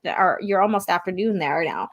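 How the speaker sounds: usual speaking voice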